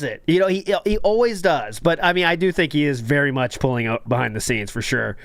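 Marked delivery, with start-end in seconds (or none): none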